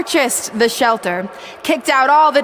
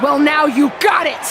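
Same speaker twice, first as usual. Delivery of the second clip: high mocking dare; taunting threat; 4 semitones higher than usual. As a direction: goad her into the fight. Brooklyn taunts before attacking.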